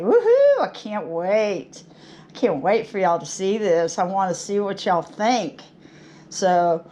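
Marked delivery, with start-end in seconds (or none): 0.0-5.6 excited whoop. Retold or imitated. Waiting for her video to come out, excited whoop. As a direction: burst out with a giddy whoop. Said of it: "I'm like".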